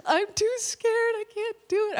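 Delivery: imitating a panicking daughter; scared child voice. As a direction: squeak it out like a frightened kid. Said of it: "And she's like".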